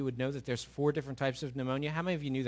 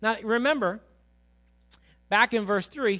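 ordinary delivery

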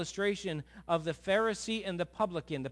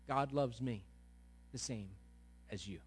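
gentle reassurance; 4 semitones lower, 8 dB quieter than usual. Real, not acted. Softly stating God's love.

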